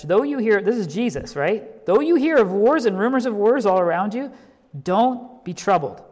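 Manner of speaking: rising preacher's cry on "Though you hear", settling on "don't be troubled"; preached scripture. Shown as a demonstration. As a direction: proclaim it to the hall as a preacher. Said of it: "Think about that message".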